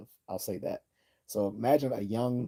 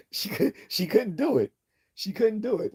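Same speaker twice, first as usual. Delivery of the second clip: gleeful disbelief; 11 semitones higher, 4 dB louder than usual. Real, not acted. Laughing marvel at the story.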